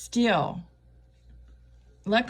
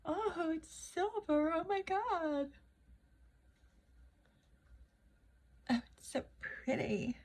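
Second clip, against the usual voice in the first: high, soft gasping delight; delighted surprise; 10 semitones higher, 9 dB quieter than usual. Real, not acted. Cooing over her sparkly silver eyeshadow, delighted. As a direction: coo over it, pleasantly stunned.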